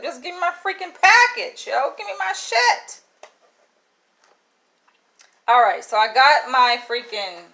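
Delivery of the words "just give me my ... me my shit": exasperated demand. Retold or imitated. Retold demand, rising.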